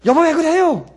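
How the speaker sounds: put-on snatch the child and scold husband; shrill wife. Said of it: "어머니들은 이렇게 해요".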